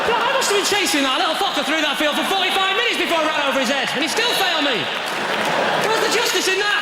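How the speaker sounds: high, shouted comic outrage; outraged rant. Acted. Comic rant about failing driving test.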